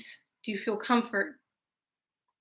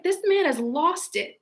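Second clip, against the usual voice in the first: slow, higher disbelief; incredulous; 7 semitones higher than usual. Retold or imitated. Her reaction to the date's accusation, slow and incredulous. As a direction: drag it out, stunned. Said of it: "And I'm just like".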